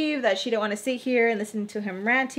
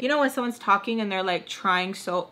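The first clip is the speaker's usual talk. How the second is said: ordinary delivery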